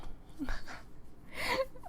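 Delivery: breathy squeaky laugh; a scared high giggle; high nervous laugh. Real, not acted. Streamer laughing in fright at the ending. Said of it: "now I'm scared".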